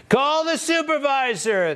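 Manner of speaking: mock the screaming protester; entitled customer. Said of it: "mocking protester's demand".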